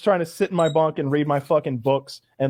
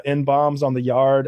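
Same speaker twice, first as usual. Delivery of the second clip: ordinary talk, nothing marked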